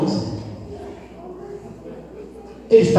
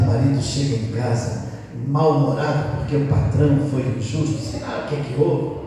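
ordinary talk

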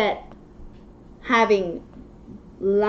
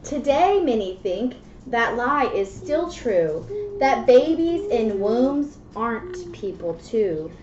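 put-on reading to children; 5 dB quieter than usual. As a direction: read the rhymes gently to a child. Reads picture book aloud.

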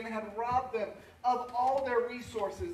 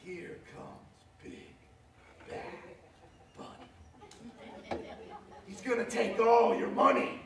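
spooky storybook villain voice; slower than usual. Retold or imitated. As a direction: creep in with a whisper, then pounce. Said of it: "I would get this voice where I would go".

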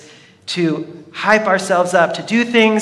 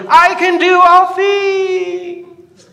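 high, slow, stretched-out shout while leaping; yelled battle cry; 12 semitones higher, 5 dB louder, much slower than usual. Retold or imitated. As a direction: shout it as he jumps off the building. Comic guy jumps off building.

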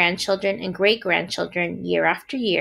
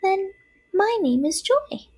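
very high, tiny spider voice; little spider; 12 semitones higher than usual. A narrator's reading. First spider names herself to Wilbur, small voice. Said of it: "said the first spider".

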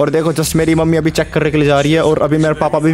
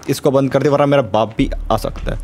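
normal talking voice

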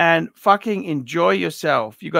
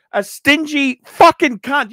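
repeated higher and louder, words swapped; louder insult; 8 semitones higher, 5 dB louder than usual. Acted. Ranting at the collector, repeating the insult louder. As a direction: hit the insult again, harder.

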